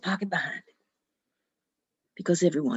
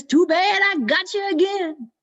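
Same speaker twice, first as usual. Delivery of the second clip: higher, louder, slow gloat; gloating Jack; 9 semitones higher, 6 dB louder than usual. Acted. Jack crows at the devil stuck up the tree, slow and gleeful. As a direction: savor the trap, crowing. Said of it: "Jack is laughing".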